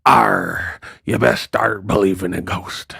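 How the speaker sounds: low, growly mock pirate. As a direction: play it like a pirate. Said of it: "She talked like a pirate".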